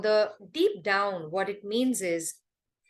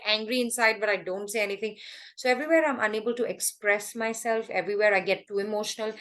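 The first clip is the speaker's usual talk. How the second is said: ordinary talk, nothing marked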